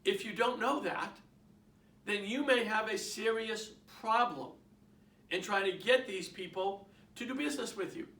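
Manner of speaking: ordinary talk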